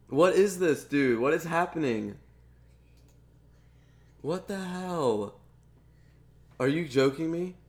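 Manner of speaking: real frustration boiling over; drawn-out what the hell; exasperated. Real, not acted. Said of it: "I'm so pissed right now".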